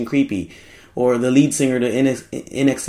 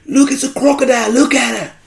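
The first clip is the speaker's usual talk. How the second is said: put-on excited Australian accent, much higher and louder; Australian croc hunter thrilled at spotting a crocodile; Steve Irwin Australian accent; 6 dB louder than usual. Host imitating Steve Irwin spotting a crocodile, excited Australian accent. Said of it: "my template for anything I wanted to say in an Australian accent".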